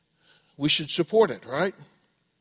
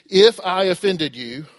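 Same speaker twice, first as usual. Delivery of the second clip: IF leaned on, slow and louder; conditional apology; 2 semitones higher, 5 dB louder, slower than usual. Acted. Wrong way to apologize to someone, stress on "If". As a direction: stretch the line, weight on "If". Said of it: "if you ever say this".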